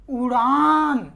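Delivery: उड़ान drawn out very slowly, falling at the end; teacher sounding out उड़ान slowly; slowly sounded out. Shown as a demonstration. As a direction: stretch उड़ान so its ending आन is heard. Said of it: "आन प्रत्यय आ रहा है".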